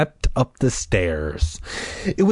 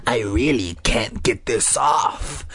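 slow, lower raspy insistence; harsh raspy voice; 4 semitones lower, slower than usual. Acted. Sister struggling with the mask, harsh raspy insistence. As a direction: rasp it slowly and insistently. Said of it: "in her harsh, raspy voice".

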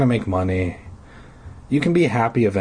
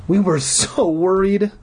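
slow, higher worried mother; worried mom; 8 semitones higher than usual. A narrator's reading. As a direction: say it slowly like a fretting mom. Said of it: "Mom said".

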